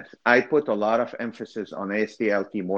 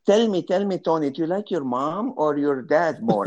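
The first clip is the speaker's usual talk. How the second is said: mean neighbor; 7 semitones higher than usual. Retold or imitated. Mean neighbor grilling a kid at Christmas, imitated in a high, pushy voice. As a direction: press the question like a nosy neighbor. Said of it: "I remember that mean neighbor".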